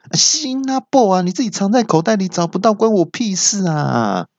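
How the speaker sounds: high-pitched mock scolding; scolding mum. Mum telling off her son over the phone, mock scolding. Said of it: "我妈就在骂说".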